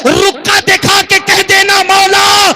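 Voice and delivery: loud, high, ringing sermon delivery; preaching at full force. Acted. Preacher rousing the congregation, loud full-force preaching. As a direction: preach it out over the gathering.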